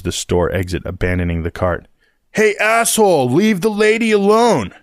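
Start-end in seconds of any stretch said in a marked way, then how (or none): 2.3-4.7 put-on high, loud teenage yell; angry teen shout. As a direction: confront him, voice pitched way up.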